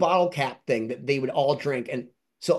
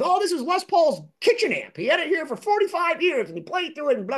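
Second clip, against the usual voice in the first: a higher-pitched retold boast; imitating Jimmy lightly; 12 semitones higher than usual. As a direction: rattle off his amp story, pitched up. Quoting Jimmy's story about the amp.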